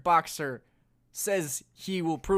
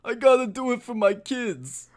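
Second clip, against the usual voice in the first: Stallone impression; 7 semitones higher, 4 dB louder than usual. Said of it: "who has the better Stallone impression".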